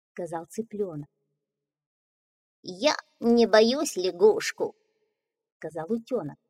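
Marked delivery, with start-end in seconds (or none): 2.6-4.8 slow, bold little duckling; brave duckling. Acted.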